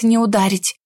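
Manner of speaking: ordinary delivery, nothing marked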